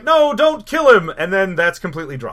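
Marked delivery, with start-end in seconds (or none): 0.0-1.1 high, loud mock pleading; hammed-up desperate protest; pleading mother. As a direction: cry out in a put-on woman's voice. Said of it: "And she's like".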